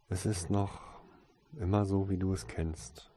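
quiet, understated sadness; subdued and mildly downcast; sad. A narrator's reading. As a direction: say it softly, a little sorrowfully. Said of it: "sagte sie traurig".

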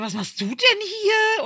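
high, loud, drawn-out question; stretched, high-pitched mock surprise; surprised retold greeting. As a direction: act out astonishment at running into a friend. Acts out surprised greeting to friend.

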